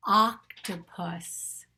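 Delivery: octopus very slow, loud on the opening ah; stressed first sound. Shown as a demonstration. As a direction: stretch out octopus from a punched first vowel. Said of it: "Octopus is the sound of the O".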